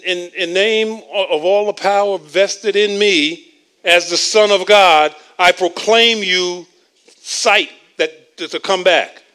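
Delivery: loud, declamatory faith-healer decree; grand proclamation. Retold or imitated. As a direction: proclaim it grandly, as if by great authority. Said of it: "he didn't say to the blind man".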